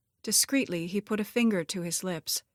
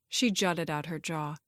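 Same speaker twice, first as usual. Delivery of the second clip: plain reading voice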